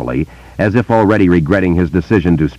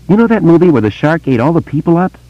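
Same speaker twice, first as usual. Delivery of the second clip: put-on higher, eager boy's voice; young boy; 12 semitones higher, 3 dB louder than usual. A narrator's reading. Boy telling Bill about voices, in a higher boy's voice. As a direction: voice a wary small boy telling a secret.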